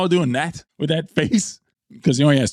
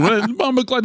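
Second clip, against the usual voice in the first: a mock Jamaican accent pitched higher; 8 semitones higher than usual. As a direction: toss out the insult in a Jamaican accent, raised pitch. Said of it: "And he flew".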